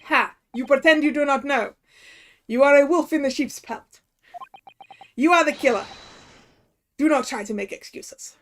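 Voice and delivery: put-on loud accusing sailor, hammy Russian accent; bad Russian accent. Sailor calling prisoner the killer, loud Russian accent. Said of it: "horrendous Russian accent I'm gonna be putting on".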